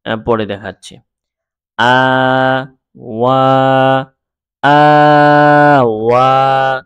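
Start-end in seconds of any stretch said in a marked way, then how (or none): 1.8-4.1 আ and ওয়া long-held with a gap; slow, separated syllable chant; letters read separately. Shown as a demonstration. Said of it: "আমি দুইভাবে পড়ে". 4.6-6.8 আ flowing slowly straight into ওয়া; slow, connected syllable chant; letters read together. Shown as a demonstration. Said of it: "আমি দুইভাবে পড়ে".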